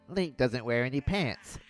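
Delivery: ordinary talk, nothing marked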